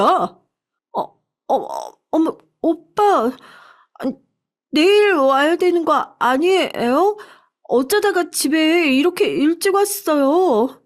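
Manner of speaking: high, stammering alarm; startled wife. A narrator's reading. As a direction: caught in the act, flustered and stalling. Wife caught by husband. (들켜서 당황한 아내의 높은 목소리)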